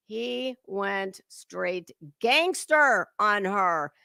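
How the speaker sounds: very slow, leaning on "gangster"; slow emphatic repeat. Real, not acted. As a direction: drag out "he went straight gangster on her" word by word, stressing "gangster". Repeats line slower for emphasis.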